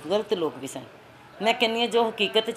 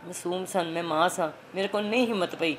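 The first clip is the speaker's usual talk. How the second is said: usual speaking voice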